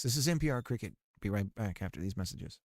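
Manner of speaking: soft, low public-radio announcer; calm, hushed station ID read; NPR voice. Pretend. Streamer doing his NPR voice for Cricket, soft announcer. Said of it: "do my N P R voice".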